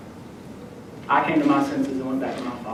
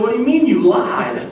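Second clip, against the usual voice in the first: louder, higher, incredulous question; mock outraged mother; 8 semitones higher, 6 dB louder than usual. Mother hearing her son confess a lie, mock incredulous. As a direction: imitate a mom's loud disbelief.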